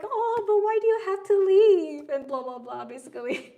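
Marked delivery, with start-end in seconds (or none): 0.0-2.1 put-on high, loud childish whine; whiny little girl. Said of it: "a little Tabitha moment being like".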